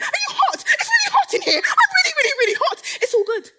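high laughing shriek of heat; hammed-up flirty squeal.

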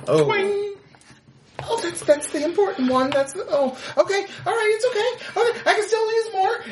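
high, wavering mock distress; panicked near tears. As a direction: fight back tears, voice climbing high. String snaps, character panics.

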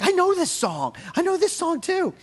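high, thrilled mock exclamation; excited recognition. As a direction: squeal it like a delighted fan spotting a tune. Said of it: "I'm like".

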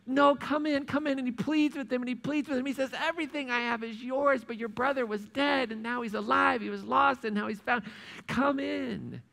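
high, earnest pleading father. Acted. Father pleads with angry older son to come in, earnestly. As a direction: coax him inside gently.

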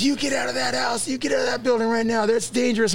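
high-pitched mock shouting of an angry lady; scolding neighbour. Neighbour lady to trespassing kids, high scolding. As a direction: imitate a neighbour ordering kids out urgently.

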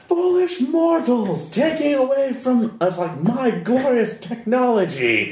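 slow, high, hammy villain voice; slow theatrical villain menace. Acted. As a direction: sneer it like a mad scientist villain.

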